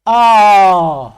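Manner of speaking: आ held long and loud, mouth wide open. Shown as a demonstration. Teacher shows students the fully open vowel आ. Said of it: "सबसे ज्यादा मुंह खुलता है".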